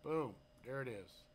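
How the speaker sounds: ordinary delivery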